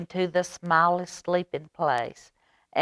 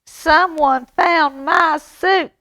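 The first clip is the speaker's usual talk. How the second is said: put-on much higher, slow baby bear sputter; 12 semitones higher, 9 dB louder, slower than usual. Baby Bear finding the soup gone, sputtering high. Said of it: "sputtered Baby Bear".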